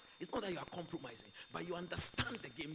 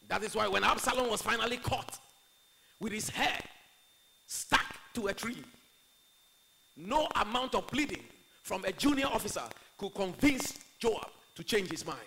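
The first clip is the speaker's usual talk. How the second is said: loud, raised preacher's voice; preaching; 5 semitones higher, 10 dB louder than usual. Acted. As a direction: drive the story home to the congregation. Preaches at full force.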